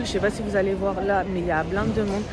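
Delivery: usual speaking voice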